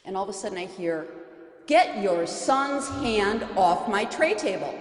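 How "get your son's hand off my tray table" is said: louder, slow, hostile order from a stranger; slow, louder irritated demand; angry rebuke. Retold or imitated. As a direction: bite out each word to a stranger's child. Stranger angrily scolds mother.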